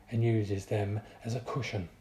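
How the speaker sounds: usual speaking voice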